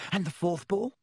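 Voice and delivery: bursting to show his photo; eager young boy. A narrator's reading. Colin voiced.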